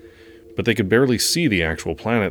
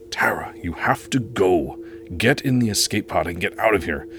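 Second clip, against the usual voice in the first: urgent whisper. Acted. Urgent warning before the attack.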